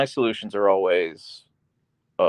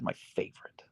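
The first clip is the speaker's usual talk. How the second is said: whispered aside; 15 dB quieter than usual. Real, not acted.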